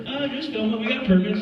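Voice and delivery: high-pitched, breezy cover story; acted innocent bluff. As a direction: play innocent to a passer-by. Bluffs to passers-by about permits.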